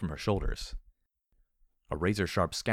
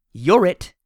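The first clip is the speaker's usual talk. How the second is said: triumphant, very loud high yell; shouting; 11 semitones higher, 15 dB louder than usual. A narrator's reading. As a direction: holler it down at the thing. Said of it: "I shouted down at the thing".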